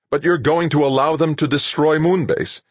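high, loud outraged question. A narrator's reading. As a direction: challenge him in outrage. Rashid outraged.

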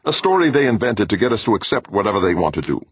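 insistent. A narrator's reading. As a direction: keep insisting, pitch up. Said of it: "Meek insisted".

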